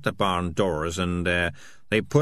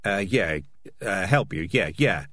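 flustered, halting agreement; hurried. Acted. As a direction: agree in hasty little bursts. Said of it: "he said hurriedly".

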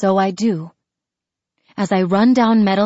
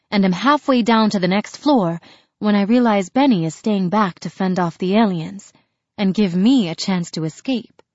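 ordinary delivery, nothing marked

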